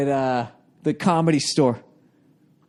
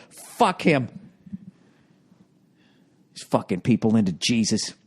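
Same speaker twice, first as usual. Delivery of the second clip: ordinary talk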